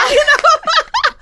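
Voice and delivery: high laughing aside. Real, not acted. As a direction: laugh through the words. Laughing at her story.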